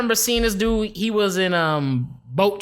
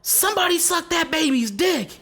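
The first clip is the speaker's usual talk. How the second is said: imitated yell; 9 semitones higher than usual. Podcaster quoting an actor yelling at a club, mock yell. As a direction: retell his yell in a raised pitch. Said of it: "he's yelling and he yells".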